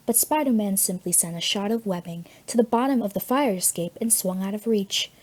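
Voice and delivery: ordinary delivery, nothing marked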